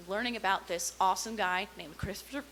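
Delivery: ordinary talk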